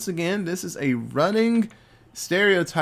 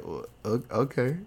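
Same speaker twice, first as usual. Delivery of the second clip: much lower, softer hesitant okay; a stunned, reluctant okay; hesitant wife; 4 semitones lower, 6 dB quieter than usual. Retold or imitated. Wife's hesitant reply voiced.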